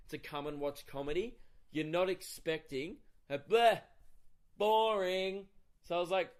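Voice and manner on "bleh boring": loud, higher, drawn-out jeer; mock heckle. Woman heckling the comic mid-set, loud mock jeer. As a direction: blurt it like a rude heckler.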